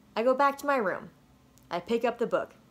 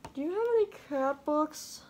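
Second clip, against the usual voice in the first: lightly voiced higher child asking; small kid asking; 7 semitones higher than usual. Boy asking librarian for cat books, small higher voice. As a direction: ask hopefully in a small voice.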